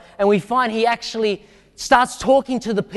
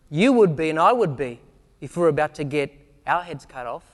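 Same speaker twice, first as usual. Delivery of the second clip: ordinary talk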